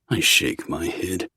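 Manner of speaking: usual speaking voice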